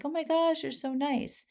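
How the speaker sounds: a lightly voiced high gush of thanks; mock-gush like you can't believe their kindness. Retelling her thanks to the fruit seller, high gushing.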